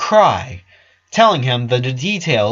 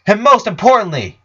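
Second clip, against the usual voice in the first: Lincoln's bitter outburst; 6 semitones higher, 5 dB louder than usual. Acted. Lincoln confronting the doctor, rising to a loud bitter outburst. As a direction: throw it back at him, grief turning to anger.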